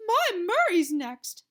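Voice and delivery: loud, slow insistent mother; pushy mother. A narrator's reading. A pushy mother claiming the next turn, loud and insistent. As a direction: demand it for your boy.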